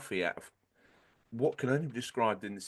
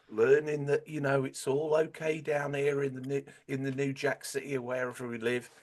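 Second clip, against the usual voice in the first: Cockney impression; 6 semitones higher than usual. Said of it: "It's the only accent I can do".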